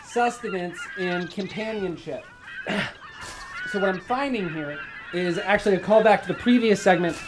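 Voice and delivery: high, strained comic persona voice; high character voice. Acted. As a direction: squeak it out like a cartoon castaway. High put-on voice held.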